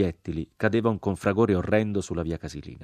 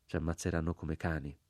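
much softer, inward murmur; repeated to oneself; 2 semitones lower, 8 dB quieter than usual. A narrator's reading. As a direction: say it only to yourself. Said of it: "ripetei dentro di me".

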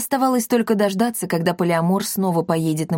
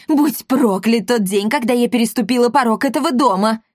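lamenting; 4 semitones higher, 4 dB louder than usual. A narrator's reading. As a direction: wail over your fate. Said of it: "принялась причитать Эсмеральда".

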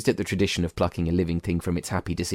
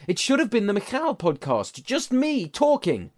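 high voice; 12 semitones higher than usual. Acted. Michal boasting, high.

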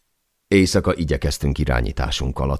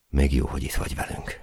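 grateful; 7 dB quieter than usual. A narrator's reading. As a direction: thank a friend quietly after the scare. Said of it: "hálálkodtam". (halk, mély hangú hálálkodás)

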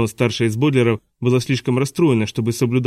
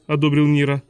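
usual speaking voice

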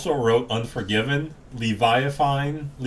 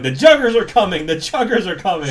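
higher, louder little hype man cry; imitated hype yell; 5 semitones higher, 5 dB louder than usual. Host imitating the movie's kid hype man, excited yelling. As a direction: announce their arrival like an excited kid. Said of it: "he just keeps yelling".

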